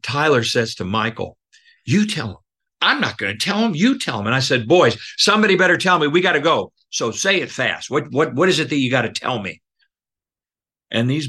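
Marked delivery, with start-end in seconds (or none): 1.8-4.3 put-on kids bickering. Boys bicker over who tells. 4.7-9.6 hurrying them. Retold or imitated. Counselor hurries boys along.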